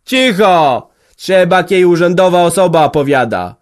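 loud, high call for silence; hushing shout. A narrator's reading. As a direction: silence the room with authority. Headman hushing the quarrel.

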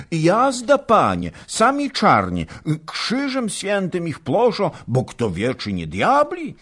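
high and agitated; fearful of devils; anxious servant. A narrator's reading. Servant fearfully telling his master, high and agitated.

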